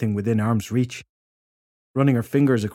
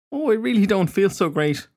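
higher-pitched voice for a woman feeling ill; unwell woman; 9 semitones higher than usual. A narrator's reading. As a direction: voice a woman who feels poorly. Alice unwell, head in hands.

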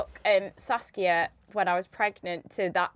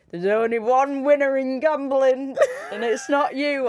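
laughing; 2 semitones higher than usual. Real, not acted. Quoting a saying while laughing.

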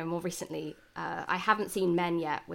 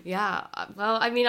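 ordinary talk

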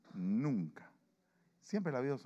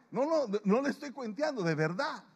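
insisting laughingly; 10 semitones higher, 5 dB louder than usual. Real, not acted. Insisting the story is true.

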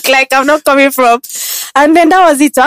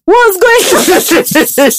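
surprised bouncer; 7 semitones higher, much slower than usual. Retold or imitated. Bouncers' reaction acted out.

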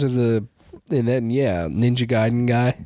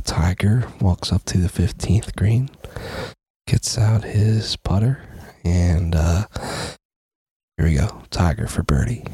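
golf announcer whisper; 4 semitones lower than usual. Pretend. Mock golf commentary on putt.

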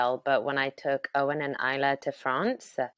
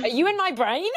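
laughing; faster than usual. Real, not acted.

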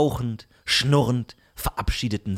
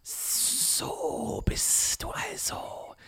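whispered hiss of a serpent; hissing snake whisper; 6 dB quieter, slower than usual. Acted. Narrator voices the giant snake.

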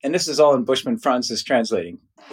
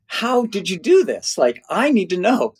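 insistent amazement; 9 semitones higher than usual. Retold or imitated. Visitor begging the Bushman ladies for answers, insistent amazement. Retold urgent plea to the ladies.

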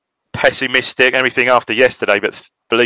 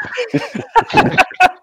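high-pitched laugh. Real, not acted. Laughs after shouting catchphrase.

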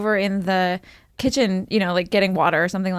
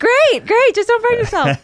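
high, louder breezy mom voice; mock mom; 13 semitones higher, 7 dB louder than usual. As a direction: answer like an unbothered mother. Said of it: "reply to kid".

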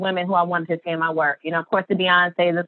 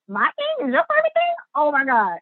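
amazed disbelief; 7 semitones higher than usual. Retold or imitated. Guest reliving seeing her head wrap in Vogue, amazed. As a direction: voice leaps much higher on the question.

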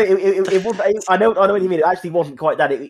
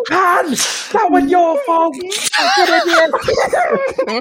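much higher, very slow mock shouting; hammed-up manager rant; much slower than usual. Co-host imitating a manager ranting after a sending off. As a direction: drag out a furious manager's complaint, laughing. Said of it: "the Ryan Lowe one".

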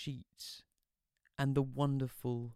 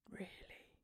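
surprised whisper; 18 dB quieter than usual. Acted. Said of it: "like she was surprised to hear it".

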